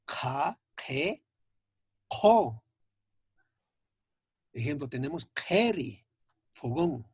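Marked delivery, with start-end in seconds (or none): 0.0-2.6 "K, K, Ko" with back k and a puff of air; throaty k released with breath in "K, K, Ko"; aspirated Q. Shown as a demonstration. Teacher demonstrating Aymara QH to students, aspirated Q. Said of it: "Q expiración". 5.3-6.0 "keri" starting on a back k with breath; aspirated Q. Shown as a demonstration. Teacher giving an Aymara QH example, aspirated Q. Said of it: "Q expiración".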